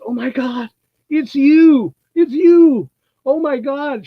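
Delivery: a fan suddenly recognizing her movie monster; starstruck excitement. Retold or imitated. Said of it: "and she goes".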